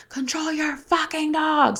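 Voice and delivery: high, drawn-out mock scream; retold scream. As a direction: imitate a man screaming, slow and strained, without full volume. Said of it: "he just screams".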